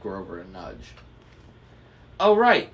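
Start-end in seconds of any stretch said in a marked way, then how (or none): 2.2-2.7 high, loud burst of agreement; loud, high-pitched cheerleading; chiming in brightly. A narrator's reading. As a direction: jump in eagerly, pitched way up. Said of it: "he chimed in".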